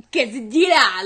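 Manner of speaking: mock-outraged, loud Spanish-accented correction; overpronounced Spanish. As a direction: belt out an overdone Spanish accent. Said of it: "like, overpronounced".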